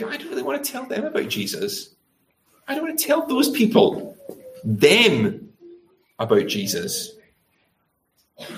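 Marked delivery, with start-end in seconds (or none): 0.0-7.1 high, soft voice of reluctance; reluctant inner voice. Acted. Preacher voicing a churchgoer's thought about bullies, high and reluctant.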